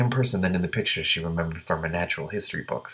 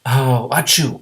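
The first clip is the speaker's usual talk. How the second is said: sneeze; 4 semitones higher, 6 dB louder, much slower than usual. Acted. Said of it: "said the gray green dragon".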